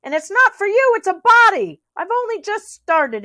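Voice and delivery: high, loud furious rabbit. A narrator's reading. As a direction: snap it out in a rage. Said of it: "said Rabbit furiously".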